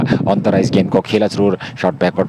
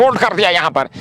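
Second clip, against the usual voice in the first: excited cricket commentary; 9 semitones higher than usual. Real, not acted. Batsman bowled, wicket falls.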